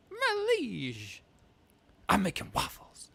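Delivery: high squeaky my liege then waffles; mock Donkey voice; goofy Eddie Murphy impression. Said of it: "it's still Eddie Murphy".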